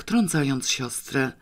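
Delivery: ordinary delivery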